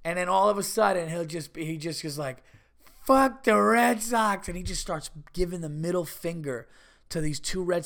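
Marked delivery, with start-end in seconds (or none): 3.0-4.5 slow, loud, raised-pitch slurring holler; drawn-out drunken heckle; drunk wrestler yell. Retold or imitated. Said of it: "like, a drunk wrestler".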